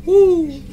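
short high celebratory "woo"; high whoop. Real, not acted. Player cheering own progress, high whoop.

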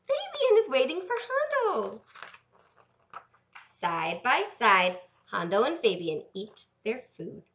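lively storybook read with character voices; reading to children. Acted. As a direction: read it like a bedtime story to little ones. Reads picture book to children.

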